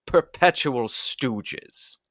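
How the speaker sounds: put-on slow, drawn-out old-timey accent; a hint of a fifties transatlantic drawl. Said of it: "he finally caught an accent that entertained me".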